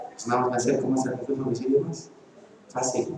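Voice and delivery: soft, low, close to the mic; no need to shout. Shown as a demonstration. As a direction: drop the volume and lean into the mic. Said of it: "No necesito gritar". (voz baja, pegado al micrófono)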